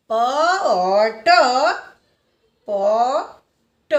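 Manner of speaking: પ, ઓ, ટ one at a time, then a very slow પોટ; sounding out. Shown as a demonstration. Teacher repeating pot slowly for learners, sounding out. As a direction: separate the sounds and stretch પોટ right out. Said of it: "પીનું ઉચ્ચારણ થશે".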